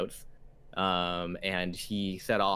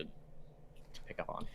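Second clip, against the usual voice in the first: trailing whisper; 15 dB quieter, much slower than usual. Real, not acted. GM fades into a whisper.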